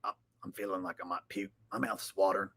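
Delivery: mock a broken man about to cry and puke; new Alex Jones. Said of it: "So new Alex Jones is like".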